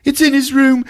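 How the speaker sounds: prim English lady. Retold or imitated. Eve telling Hugh what she saw, hammed-up high prim lady. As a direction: play a flustered posh lady. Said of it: "Eve's voice".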